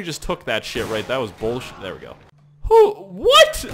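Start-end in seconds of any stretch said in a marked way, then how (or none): 2.6-3.7 very high, loud yelp; squawked startled questions; shocked disbelief. Real, not acted. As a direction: blurt it out in astonishment. Sees shocking enemy in game.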